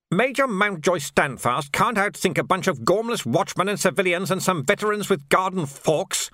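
high, dismissive officer voice; scoffing lord. A narrator's reading. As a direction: brush off the report with scorn.

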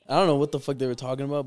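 ordinary talk, nothing marked

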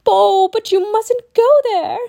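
put-on high, loud, slow girl's warning; drawn-out alarmed child voice; alarmed cry. A narrator's reading. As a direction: cry out a warning as a village girl. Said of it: "a girl cried".

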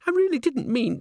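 put-on very high, girlish pleading; apologetic diva. A narrator's reading. Christine hurries after Agnes, apologising.

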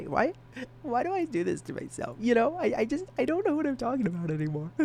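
high fast squeaky whine; squeaky high rant. Acted. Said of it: "Why am I talking like this".

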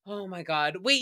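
usual speaking voice

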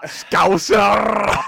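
big, high drawn-out yell; teasing shout. Pretend. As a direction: bellow it to wind up your mate. Yells an answer to tease a friend.

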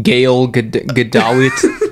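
"Gadot" garbled into "guh-DOLL-it"; playful wrong "Gadot" with an extra syllable, rising; joke mispronunciation. Pretend. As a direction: mangle it as "Gail guh-D..., guh-DOLL-it". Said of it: "they pronounce it different every".

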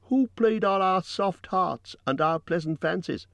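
high-pitched rhetorical questioning; loud and clear. A narrator's reading. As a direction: declaim each question high to the crowd. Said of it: "spoke very loud and clear".

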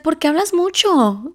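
a touch of a mother teasing her chatty daughter; imitating mom. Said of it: "but she's like".

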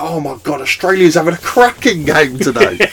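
hammed-up excitement, pitched high; playing up a boyish bowls fan, high; mock excited fan. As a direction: gush over the bowls match like a superfan. Imitating himself excited about bowls.

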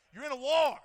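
loud preacher's cry. Shown as a demonstration. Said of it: "repeated line, soft then loud".

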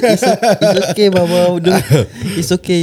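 put-on high, soothing child's voice; mock soothing child. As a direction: coo at Baba like a little kid. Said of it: "jangan anak dia yang datang".

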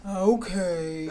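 lightly put-on Southern drawl; Ray's Southern accent. Mimicking husband Ray's Southern accent while telling friends. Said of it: "I speak in a".

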